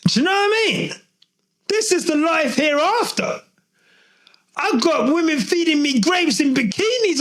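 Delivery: high, elated cry of relief; joyful relief. Pretend. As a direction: celebrate like you reached heaven. Hamilton voiced arriving at Ferrari.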